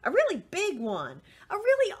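rising to a high eager really; mother building excitement; excited mother. Acted. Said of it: "His mother thought".

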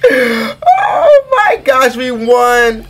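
very high, loud, stretched squeals easing into high cheers; real triumphant fan outburst; ecstatic victory screaming. Real, not acted. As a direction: scream it overjoyed, then keep cheering. Celebrating a win in the game.

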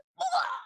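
high airless death cry; death sound. Shown as a demonstration. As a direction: die with no air left. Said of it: "just sounds great when there's no air".

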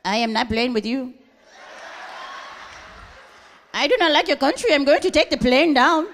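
put-on menacing foreign accent, building up; Habib. Habib threatening the plane passengers, accented and menacing. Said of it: "Here come Habib".

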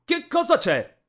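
high, loud petulant outburst; throwing a tantrum. A narrator's reading. Rich boy barging in on the two, loud tantrum. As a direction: demand it like a spoiled rich kid. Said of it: "apparve Jace facendo i capricci".

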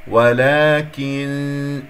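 ولكن very slow, ending in a long nasal hum; hidden nun. Shown as a demonstration. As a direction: stretch ولكن and hold the veiled n. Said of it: "نون شين إخفاء".

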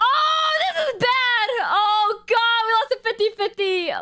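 high-pitched wail of dismay; dismayed outburst. Real, not acted. Streamer losing a fifty-fifty on Haley's account, distressed wail. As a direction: cry out in real panic, voice shooting up.

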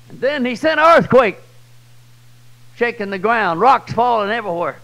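loud, high telling of the earthquake; dramatic preaching. A narrator's reading. Preacher painting the earthquake for the crowd, loud and high. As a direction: make the ground shake in the telling.

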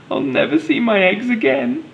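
high, hammed-up hopeless cry. Helen walking home without her eggs, high mock-tearful cry. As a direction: lift high and lament it like a storybook mum. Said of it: "she cried".

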